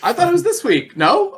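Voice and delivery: high, surprised disbelief. Real, not acted. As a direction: caught off guard, voice jumps up. Surprised at a correction.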